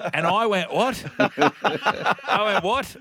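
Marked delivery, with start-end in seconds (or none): 0.7-1.0 high, loud mock disbelief; baffled. Customer baffled by the takeaway lady's accent, acted disbelief. Acts out his baffled reply. 2.6-2.9 high mock disbelief, laugh in it; baffled. Customer baffled by the takeaway lady's accent, acted disbelief. Repeats his baffled reply.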